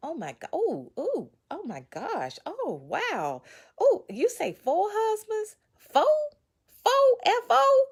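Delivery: gasping, rising to a high squeal of disbelief; shocked disbelief. Retold or imitated. Rereading her own book, shocked by four husbands. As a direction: act stunned at your own past. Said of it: "I'm like, Ooh".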